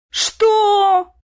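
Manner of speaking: very high, loud, drawn-out shriek; indignant yell. A narrator's reading. House spirit hearing of the marriage, indignant yell. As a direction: yell it high and long in outrage. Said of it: "Возмущённый вопль невидимки".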